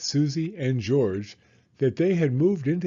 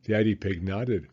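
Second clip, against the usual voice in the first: ordinary delivery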